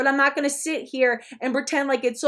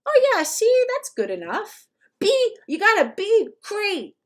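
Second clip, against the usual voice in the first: mock cheerful praise; 7 semitones higher than usual. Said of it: "I'd be like".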